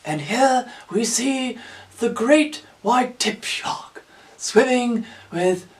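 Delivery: slow, hushed nature documentary narration; mock wildlife-film narrator; David Attenborough impression. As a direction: narrate reverently, drawing out each phrase. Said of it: "you expect David Attenborough to suddenly stop".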